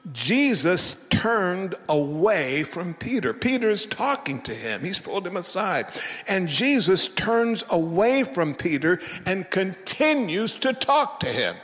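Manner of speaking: fervent sermon storytelling; emphatic preaching. Acted. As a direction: drive the story home from the pulpit.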